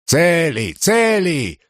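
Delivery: high-pitched narrated call to a friend. A narrator's reading. Friends calling out to Celius.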